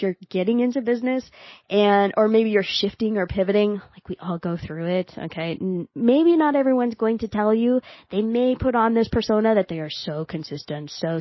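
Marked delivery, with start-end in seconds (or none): none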